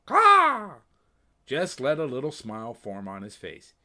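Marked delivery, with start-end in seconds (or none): 0.1-0.8 high, loud raven squawk; sharp bird call; raven caw. Acted. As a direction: caw like a crow. Raven Anna interrupts.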